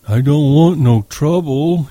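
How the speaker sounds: put-on slow, pleading childlike voice; plaintive. A narrator's reading. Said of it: "he said plaintively".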